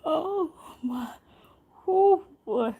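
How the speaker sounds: laughing. Real, not acted. Recovering from laughter.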